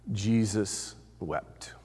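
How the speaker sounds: slow, near-whispered statement; soft, grave and unhurried; hushed solemnity. Real, not acted. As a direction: let it fall to a low hush. Solemn climax of the grief story.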